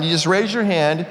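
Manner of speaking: ordinary talk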